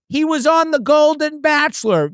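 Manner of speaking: high-pitched imitation of a woman announcing; mock yell across a bar. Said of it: "they're yelling it".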